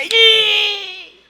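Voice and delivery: high loud squeal of fright; a sudden shrill yelp; startled groom yelps at what he sees. Retold or imitated.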